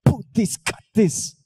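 "Put this, cut this" punched out as drum hits; beatbox pronunciation. Shown as a demonstration. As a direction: hit every consonant of "Put this, cut this" like percussion. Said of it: "Now say it after me".

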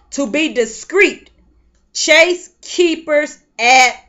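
slow, weighty reading of the verse; scripture read slowly. Shown as a demonstration. Preacher reading verse five to the women, slow and weighty. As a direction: lean on every word of the verse. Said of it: "reading scripture slowly".

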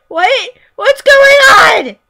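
loud, high, slow confused shout; real baffled yell. Real, not acted. Confused by game result.

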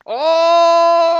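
sudden high yelp; loud surprised shout. Real, not acted. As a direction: shout out in shock. Sudden exclamation mid-game.

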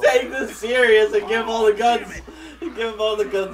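laughing shout. Real, not acted. Laughing plea during game.

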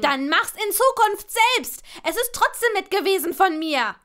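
high, loud huffy protest; squabbling. A narrator's reading. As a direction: snap back in a quarrel. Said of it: "wie die beiden sich rangelten".

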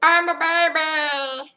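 put-on very high, slow babyish squeal; baby mask talking in a squeaky baby voice. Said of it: "video masks".

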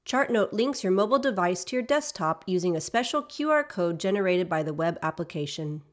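usual speaking voice

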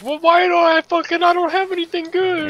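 high, loud whiny complaint; whiny friend impression. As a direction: whine it like a spoiled teammate. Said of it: "He's like".

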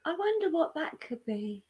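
high, soft, dainty musing; mock polite wondering. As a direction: wonder aloud with feigned innocence. Acting out an unlikely polite reaction.

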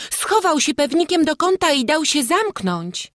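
put-on very high, loud girlish voice; girl calling out. A narrator's reading. Girl tells crowd how thief hid, high excited call. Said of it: "zawołała jakaś dziewczyna".

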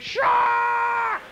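high, long carrying yell; big loud shout. Acted. Swimmer warning the whole beach, loud long shout. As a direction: send it all the way back to the beach. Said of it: "Reaches all the way back to the beach".

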